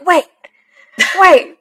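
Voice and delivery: urgently wait, wait; excited wait. Retold or imitated. Excited fan stopping the episode. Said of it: "smacked the nothing next to me and was like".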